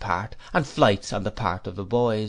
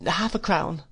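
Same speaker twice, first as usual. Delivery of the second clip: timid answer; 7 semitones higher than usual. A narrator's reading. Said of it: "I answered, recovering myself".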